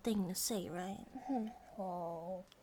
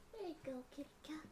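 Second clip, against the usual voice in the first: very soft, higher murmur; 3 semitones higher, 12 dB quieter, faster than usual. Acted. Girlfriend wrapping the kitten in a blanket, very softly.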